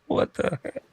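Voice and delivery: breathy hushed what the heck; startled whisper at a strange sight; whispered disbelief. Retold or imitated. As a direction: whisper it like you can't believe your eyes. Retold startled reaction at night.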